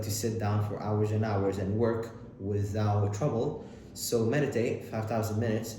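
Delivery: ordinary talk, nothing marked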